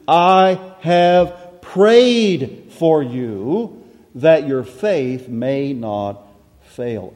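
"I have prayed for you" drawn out slowly, "prayed" lifted; slow solemn quote. Shown as a demonstration. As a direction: read the promise slowly and solemnly. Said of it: "the you in verse thirty-two is singular".

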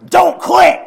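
put-on high, loud growling old man; growly old man. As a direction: bellow it like the old preacher. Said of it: "He's saying".

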